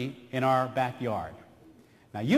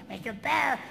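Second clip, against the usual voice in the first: practised duck voice; 7 semitones higher, 3 dB quieter than usual. Retold or imitated. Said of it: "I do it for her every day".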